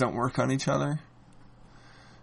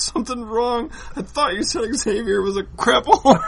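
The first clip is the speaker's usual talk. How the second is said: mock villain line; 9 semitones higher, 5 dB louder than usual.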